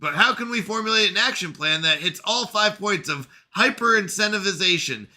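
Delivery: lightly voiced bitter machine intelligence; AI character voice.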